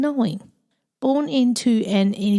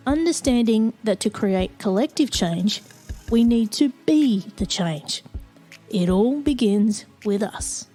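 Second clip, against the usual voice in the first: measured announcer read over music; podcast intro read; 3 dB quieter than usual. Acted. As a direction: read it like a polished show opener.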